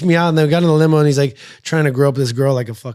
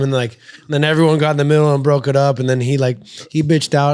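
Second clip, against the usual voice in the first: ordinary delivery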